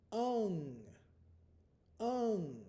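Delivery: Ung held high in the nose; glued ung sound. Shown as a demonstration. Teacher modeling the glued ung sound. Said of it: "the glued sound".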